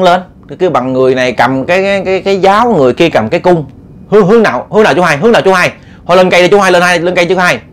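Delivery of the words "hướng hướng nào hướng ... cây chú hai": rapid, high-pitched panicked urging; urgent alarm. Acted. Men in the tree urge uncle to climb, urgent alarm. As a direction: fire off anxious, repeated questions and pleas.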